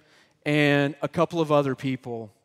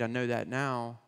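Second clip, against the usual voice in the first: ordinary talk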